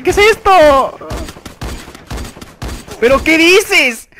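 high, loud, drawn-out protest; stretched, high-pitched outrage; incredulous outcry. Real, not acted. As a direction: cry out slowly in disbelief. Outraged at what happens.

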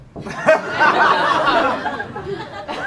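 long rising laugh. Real, not acted. Long laugh at the nickname he got in school. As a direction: laughs hard and high.

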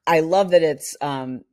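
usual speaking voice

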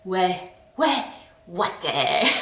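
lightly voiced teenager trying to sound cool; a hint of a slangy teenager; cool French teen. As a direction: toss off "Ouais, ouais, ouaté" like a try-hard teen. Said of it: "a French teenager trying to be cool".

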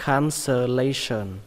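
"cancellation" said syllable by syllable, slowly; slow take. Shown as a demonstration. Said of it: "Đọc nhanh là".

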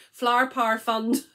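Flower Power Fund in a non-Scottish accent. Shown as a demonstration. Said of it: "not in my accent".

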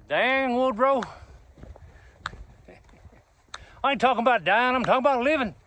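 high-pitched old-West cowboy impression; Gus from Lonesome Dove. Fan quoting his favorite Western to Woodrow, cowboy impression. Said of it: "Augustus, or Gus, and Woodrow Call".

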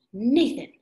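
loud, high alarmed call; old woman calling for Nathan in alarm, loud and high; alarmed cry. Acted. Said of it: "she cried".

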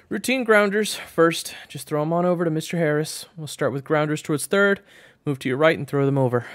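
high, loud coach's shout; coach calling drills. Acted. Coach sends grounders to shortstop, high loud calling. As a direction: call instructions out across the field.

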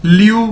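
ल्यू said loud with a rising tone; model pronunciation. Shown as a demonstration. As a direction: glide ल्यू as one syllable, rising. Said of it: "लेओ नहीं इसको पढ़ना".

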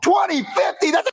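high, incredulous yelp; shocked outcry. Acted. Friend hears the delayed release date, high incredulous cry. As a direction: yelp it in disbelief.

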